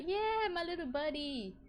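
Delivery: high doting pet talk; cooing to a pet. Real, not acted. Streamer admiring their cool cat, cooing high.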